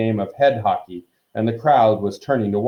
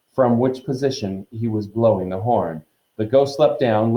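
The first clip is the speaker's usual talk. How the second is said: ordinary delivery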